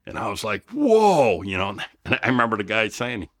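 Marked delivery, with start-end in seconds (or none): 0.7-1.4 a loud, high, half-mock whoa of shock; high startled whoa. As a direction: throw out a startled whoa, louder and higher. Seeing the toothless old women.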